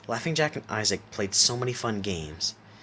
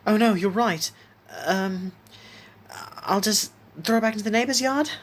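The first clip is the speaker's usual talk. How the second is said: put-on high, hesitant child in a panic; panicked; 13 semitones higher, 4 dB louder than usual. A narrator's reading. Isaac realises he could be in trouble, panicked and high. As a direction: panic in a high voice, groping for a fix. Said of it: "Isaac panicked".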